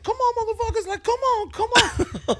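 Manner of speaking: high, loud mock fight call; imitating angry challenge. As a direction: yell it like she's squaring up to brawl. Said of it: "she starts to get mad".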